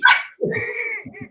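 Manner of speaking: a high, bright burst of laughter; amused laugh. Real, not acted. Telling the radio host about his giddy mom, laughing. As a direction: laugh out at the memory.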